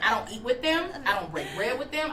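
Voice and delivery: normal talking voice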